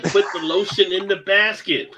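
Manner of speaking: high cat voice; cat speak. Pretend. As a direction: squeak it like a cat. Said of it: "in cat speak".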